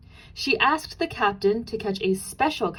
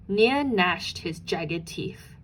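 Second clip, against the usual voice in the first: ordinary delivery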